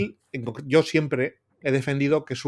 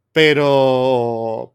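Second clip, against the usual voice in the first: normal talking voice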